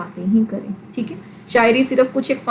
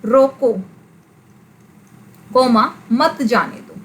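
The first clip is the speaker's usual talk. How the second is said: रोको, long pause, then मत जाने दो; long halt after रोको before the rest; punctuation read aloud; slower than usual. Shown as a demonstration. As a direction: dictate रोको, speak the comma, then मत जाने दो. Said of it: "जैसे बड़ा फेमस है".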